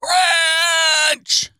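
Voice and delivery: high, loud cried-out ranch; silly shout. Pretend. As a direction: belt the word out as a joke. Joking cry of ranch.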